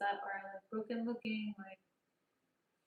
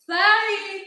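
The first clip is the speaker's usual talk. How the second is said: loud; 10 semitones higher, 19 dB louder than usual. Real, not acted. Sudden loud apology.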